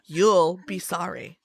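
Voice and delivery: usual speaking voice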